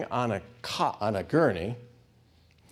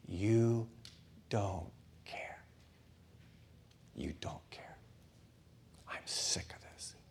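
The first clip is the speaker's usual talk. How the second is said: a hushed, voiceless whisper of inner disgust; bitter whisper; 3 semitones lower, 11 dB quieter, slower than usual. Retold or imitated. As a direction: whisper it like a secret thought. Inner voice of despair.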